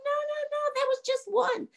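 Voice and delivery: high, soft, teasing brush-off; breezy dismissal. Acted. Young man answers the screaming ghost, high breezy dismissal. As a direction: wave away the complaint in a high soft voice.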